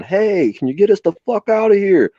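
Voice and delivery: high, loud desperate call; imitating trapped women calling out; trapped people shouting. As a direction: yell from inside to be freed. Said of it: "We can hear two people inside going".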